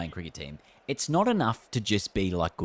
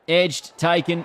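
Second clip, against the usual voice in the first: excited commentary call; 9 semitones higher, 8 dB louder, much slower than usual. Real, not acted. Live cricket game commentary.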